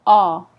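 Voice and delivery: a long open "ah" said loud on its own; isolated vowel sound. Shown as a demonstration. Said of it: "Stress on the ah".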